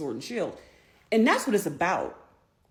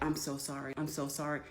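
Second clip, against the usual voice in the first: soft, low, subdued apology; retold tearful apology; 3 semitones lower, 9 dB quieter than usual. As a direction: apologize quietly, retelling a sad moment. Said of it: "I was, like, crying".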